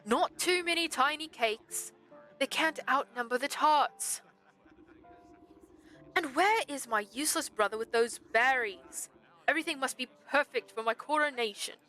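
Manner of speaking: pompous prince holding court; most pompous voice. Acted. Prince fussing over his coronation, utterly pompous. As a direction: lord it over everyone. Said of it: "absolutely most pompous voice".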